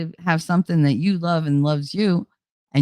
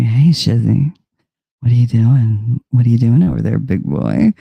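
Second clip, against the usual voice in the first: lower, louder mock flirt at the dog; low teasing voice; 6 semitones lower, 6 dB louder than usual. Teasing her dog Shizzy, mock low flirt. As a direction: tease him in a low playful voice. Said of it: "I was like".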